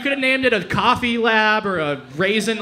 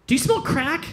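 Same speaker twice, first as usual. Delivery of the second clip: mock-casual question, pitched up; 7 semitones higher than usual. Guy with a pipe asking a new friend, very casual. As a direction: ask it as offhandedly as a small favor. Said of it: "Very, very casual".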